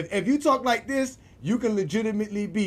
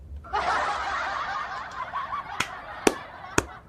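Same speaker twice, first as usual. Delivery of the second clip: high, unvoiced wheezing laughter; high breathless laugh; 3 dB quieter than usual. Real, not acted. Cracking up over the ban notice, high wheezing laugh.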